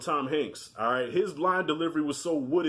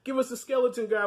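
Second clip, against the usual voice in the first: eager, higher voice, half laughing; excited; 9 semitones higher than usual. Retold or imitated. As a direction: beg for the skeleton guy. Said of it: "I said".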